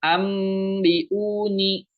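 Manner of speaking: "ambiuni" drawn out with a closed, clear m; slow "ambiuni", m fully sounded; m too clear. Shown as a demonstration. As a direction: hum the m of "ambiuni" with lips pressed.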